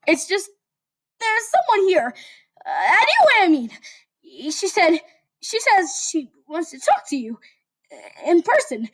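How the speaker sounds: very high, nervous whiny voice; whiny Deku. Acted. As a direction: whine it out anxiously. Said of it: "whiny verse voice".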